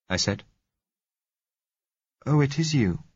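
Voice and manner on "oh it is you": lightly voiced young woman, higher; a hint of a young girl, surprised; girl's voice. A narrator's reading. Girl at the door recognizing the narrator, lightly voiced higher girl. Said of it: "said a girl's voice".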